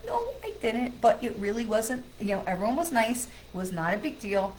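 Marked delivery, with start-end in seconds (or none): 0.1-1.0 very high sing-song answer; amused denial. Real, not acted. Storyteller on dealing with all that stuff, amused denial. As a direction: pitch it way up for a comic denial.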